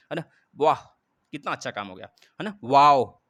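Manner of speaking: a loud, happy "वाओ" burst out to show joy; delighted wow. Shown as a demonstration. Teacher showing students how joy is exclaimed, loud delighted wow. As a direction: sell the delight as a teacher's demo. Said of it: "खुशियाँ व्यक्त करना".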